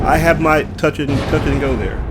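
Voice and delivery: normal talking voice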